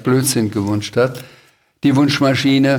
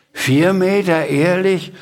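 put-on higher-pitched astonished boy; amazed question; 6 semitones higher than usual. Boy answering the Sams's kite boast, amazed. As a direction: voice lifts in disbelief.

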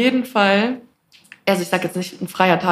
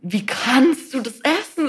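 ungläubig; 5 semitones higher, slower than usual. Retold or imitated. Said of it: "Und ich bin so".

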